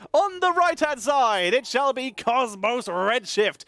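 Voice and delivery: hammed-up prize fight announcer; ring announcer. Boxing ring announcer intro.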